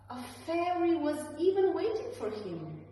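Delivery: plain reading voice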